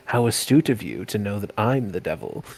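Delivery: soft, low murmur in the ear; conspiratorial whisper. Acted. Vati speaks into the villager's ear, conspiratorial hush. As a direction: drop it low and quiet, confidentially. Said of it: "whisper in ears, like".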